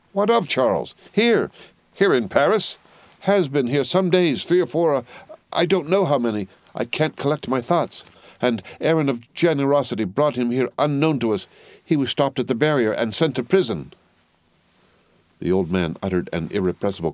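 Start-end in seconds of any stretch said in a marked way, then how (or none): 0.1-2.8 high-pitched, startled disbelief; alarmed questioning. A narrator's reading. Friend's alarmed questions at bad news. 3.2-13.9 higher-pitched, agitated account; anxious, raised voice; distraught wife. A narrator's reading. Distraught wife reports husband's arrest.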